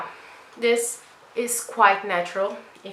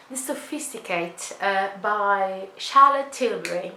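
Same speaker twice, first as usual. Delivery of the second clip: fake British accent. Makeup vlogger names Charlotte Tilbury in a mock British accent. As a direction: play at a posh British accent and half miss it. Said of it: "This is my fake British accent".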